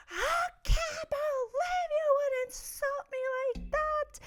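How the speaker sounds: hammed-up tantrum cry; retold tantrum. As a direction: reenact the meltdown at a high slow wail. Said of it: "threw my full-on tantrum".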